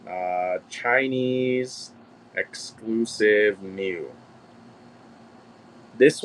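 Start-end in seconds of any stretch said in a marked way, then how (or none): 0.1-4.1 slow, drawn-out monotone; absent-minded slow drone while searching; typing aloud. Real, not acted. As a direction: stretch each word out as you type it. Reads out search words while typing.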